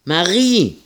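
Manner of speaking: high, loud call across the farmyard. Acted. Ida calling across the yard for Marie. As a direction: call her name out, falling at the end. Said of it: "rief Ida noch einmal".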